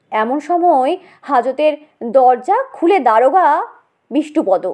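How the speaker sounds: ordinary delivery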